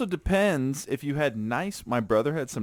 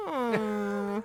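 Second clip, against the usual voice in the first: high, soft motor whine; imitated motor hum; 9 semitones higher, 7 dB quieter than usual. Mimicking an overloaded animal cart at the mall, motor whine. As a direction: hum like a small straining motor. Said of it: "you can hear the motor going".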